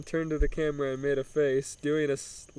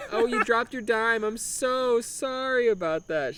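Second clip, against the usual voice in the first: teasing; 7 semitones higher than usual. Acted. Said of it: "she teased".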